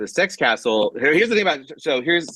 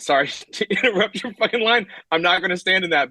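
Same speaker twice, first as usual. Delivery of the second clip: laughing; 9 semitones higher than usual. Retold or imitated. Laughing apology to Matt.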